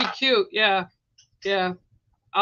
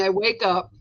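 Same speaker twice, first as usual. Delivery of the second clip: usual speaking voice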